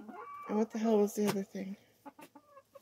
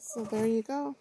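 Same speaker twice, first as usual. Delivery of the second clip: normal talking voice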